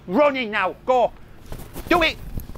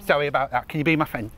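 lower, gentle plea to be friends; pleading apology; 4 semitones lower than usual. Real, not acted. Apologizing to sister after shouting.

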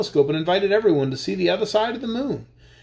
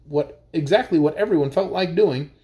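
ordinary delivery, nothing marked

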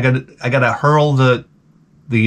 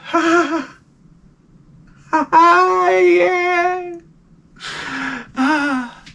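very high, strained sighing "yeah"; faked happiness; 14 semitones higher, much slower than usual. Guest hiding misery while everyone looks at him, faked happiness. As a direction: fake pleasure in a tiny falsetto.

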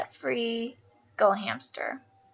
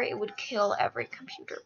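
normal talking voice

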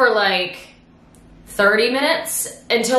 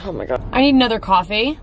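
usual speaking voice

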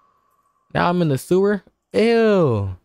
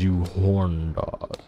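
low mock scolding; 5 semitones lower, 6 dB quieter, much slower than usual. Scolding the character.

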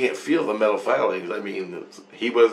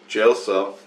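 normal talking voice

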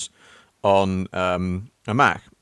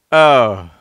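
loud dismayed oh; 3 semitones higher, 12 dB louder than usual. Real, not acted. Dies to the monster.

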